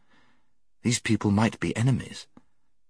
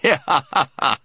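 hearty mocking laugh; loud laugh; 6 semitones higher, 4 dB louder than usual. A narrator's reading. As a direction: laugh loudly at the idea. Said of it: "He laughed very loudly".

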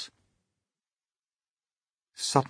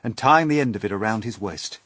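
ordinary delivery

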